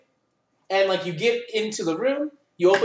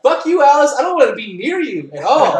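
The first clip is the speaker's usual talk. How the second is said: higher and louder, venting at Alice; mock angry outburst; 6 semitones higher, 7 dB louder than usual. Player snapping at Alice after her vote, mock furious. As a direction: let the frustration burst out at her. Shouting back at Alice in game.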